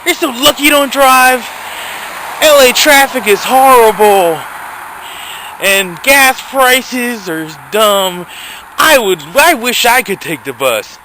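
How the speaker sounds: mild high sing-song mock pity; mocking sympathy. Vlogger mimics people who say he's lucky to ride the bus, mildly mocking. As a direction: lightly mimic a smug driver. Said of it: "you're gonna tell them".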